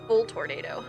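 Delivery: usual speaking voice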